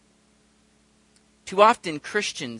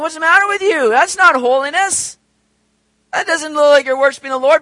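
incredulous rebuke; 7 semitones higher, 8 dB louder than usual. Acted.

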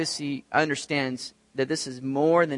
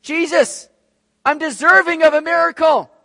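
loud, high demanding shout; acted entitled yell; 11 semitones higher, 9 dB louder than usual. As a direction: holler as if owed a miracle. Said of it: "he's not yelling out saying".

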